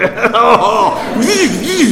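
high, loud delighted laugh; gleeful laugh. Real, not acted. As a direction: laugh with glee.

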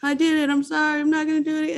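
high, pleading promise to quit; whiny empty promises. Retold or imitated. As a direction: beg like a relapsing drunk who means it each time. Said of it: "those empty promises of".

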